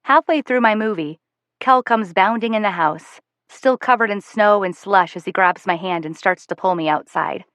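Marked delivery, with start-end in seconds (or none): none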